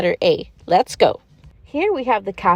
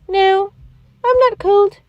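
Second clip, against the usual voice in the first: put-on much higher kid voice replying to teacher; child answering; 8 semitones higher than usual. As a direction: answer brightly like a little pupil. Pretend pupil answers the teacher.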